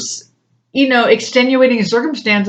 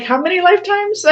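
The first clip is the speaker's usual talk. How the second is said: high disbelieving question; incredulous; 11 semitones higher than usual. Retold or imitated. Answering the psychic, high and incredulous. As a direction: gasp it out in disbelief. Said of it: "And I'm like".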